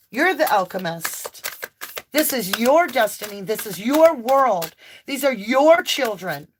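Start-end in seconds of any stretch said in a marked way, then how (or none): none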